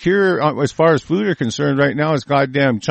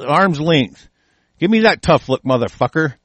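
ordinary delivery

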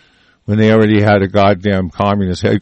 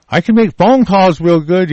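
higher-pitched, eager mock boast; raised voice mocking a clueless braggart; mocked rich boaster; 8 semitones higher than usual. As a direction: play a useless boss bragging. Acts out a useless rich man boasting.

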